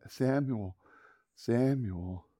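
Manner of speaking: soft, unhurried call; gentle calling. Acted. God calls the boy a fourth time, softly. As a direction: call a sleeper softly by name.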